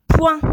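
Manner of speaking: Point. loud, high and short; dictated syllable. Shown as a demonstration. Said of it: "une dictée de syllabes".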